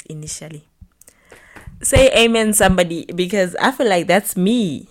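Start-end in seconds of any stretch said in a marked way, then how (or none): 1.8-4.9 loud church call-out. Real, not acted. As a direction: shout it like a preacher. Preacher-style call to audience.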